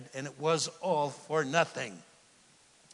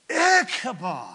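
mournful naming; 8 semitones higher, 8 dB louder than usual. Acted. Dying mother names child.

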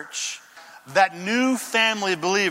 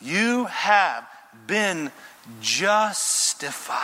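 slow emphasis; much slower than usual. Acted.

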